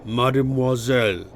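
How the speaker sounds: dull voice that carries; dull cutting voice. A narrator's reading. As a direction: dully cut through the noise. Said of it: "hearing the dull voice cutting through".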